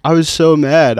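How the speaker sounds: high, loud exasperation; heated, indignant telling; worked up. Real, not acted. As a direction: vent still-fresh outrage. Recalling anger, worked up.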